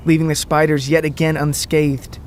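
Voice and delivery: ordinary delivery, nothing marked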